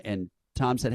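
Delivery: ordinary talk, nothing marked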